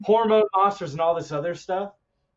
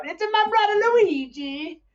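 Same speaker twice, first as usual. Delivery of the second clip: very high video game mascot voice; Mario; 4 dB louder than usual. Acted. Podcaster as game hero naming his brother, Mario voice. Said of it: "you occasionally hear the Mario crack that out".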